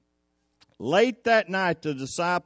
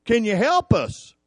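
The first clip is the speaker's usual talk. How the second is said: high, raised-voice cry for help; urgent plea; 7 semitones higher, 5 dB louder than usual. Acted. Disciples waking Jesus in a sinking boat, urgent plea. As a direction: wake him with an alarmed, acted plea. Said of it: "had to wake Jesus up and go".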